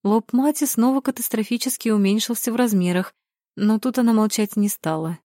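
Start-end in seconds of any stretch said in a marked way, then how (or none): none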